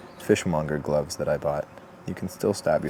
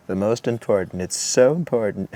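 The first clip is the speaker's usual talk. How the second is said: lightly done Trump, louder and punchy on the so; a bad half-joking Trump voice, hammering the so; Trump impression; 4 semitones higher, 5 dB louder than usual. Carving teacher jokes that the scoop cut matters, in a light mock Trump voice. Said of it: "My Trump accent's pretty bad".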